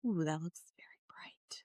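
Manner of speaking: soft unvoiced ooh to herself; whispered aside. Real, not acted. Whispering to herself while looking at the pictures. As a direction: breathe it like a secret to yourself.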